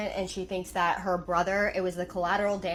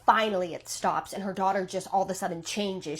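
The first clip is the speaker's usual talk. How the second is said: usual speaking voice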